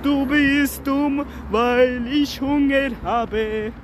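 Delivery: drawn-out silly comeback; slow mock retort. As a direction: stretch every word out teasingly. Joking retort between friends.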